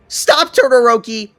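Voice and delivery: high, loud shout; desperate cry to stop him; yelling. Acted. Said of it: "Ochako yells again".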